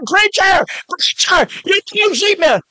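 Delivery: high-pitched imitation of a screaming man; retold scream. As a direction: cry out for the preacher as if under attack. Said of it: "And he's screaming".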